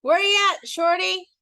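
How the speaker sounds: a loud, high hello from the restaurant; calling out. A narrator's reading. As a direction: shout a friendly greeting out of the window. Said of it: "Queen Lola called out".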